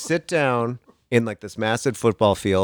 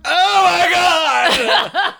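1 pained laughing shout; 7 dB louder, much slower than usual. Retold or imitated. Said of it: "I'm like".

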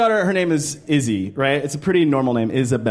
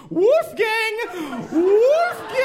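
much higher mock yoo-hoo call; mother calling; much slower than usual. Mother calling her kid in the building, high mock call. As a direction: call out like a fussy parent. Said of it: "the mother goes".

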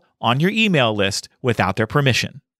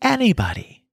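higher, breathy life coach parody; 9 semitones higher than usual. Retold or imitated. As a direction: chirp it like a breezy coach. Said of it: "high-pitched and breathy voices".

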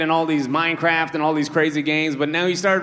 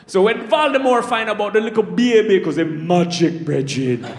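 storytelling in a Jamaican patois; Jamaican accent; 3 semitones higher than usual. Retold or imitated. Comic retelling Harry Potter to friends, in a Jamaican accent. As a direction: tell it like a Jamaican yardman. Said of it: "in a Jamaican accent".

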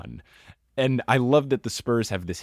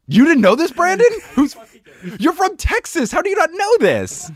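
much higher and louder, disbelieving; can't believe he doesn't know this; incredulous; 8 dB louder than usual. Real, not acted. Amazed friend doesn't know.

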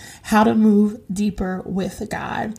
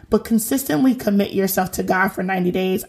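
ordinary talk

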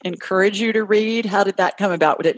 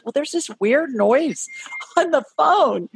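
put-on higher, slow puzzled teen quote; imitated bafflement; 7 semitones higher, slower than usual. Son reporting the dial tone to mom, imitated bafflement. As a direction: act out a baffled kid, amused.

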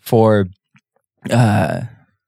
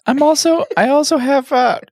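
fake Jan voice; 14 semitones higher than usual. Cohost fakes absent Jan's voice for a joke, high put-on voice. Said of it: "Jan, you don't sound like that".

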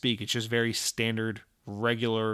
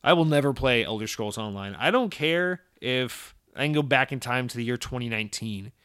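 ordinary talk, nothing marked

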